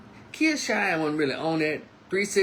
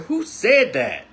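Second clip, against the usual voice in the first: slow, raised and firm; stern demand; 8 semitones higher, 6 dB louder, slower than usual. Retold or imitated. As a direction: stop and turn on him, demanding. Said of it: "And I meant it".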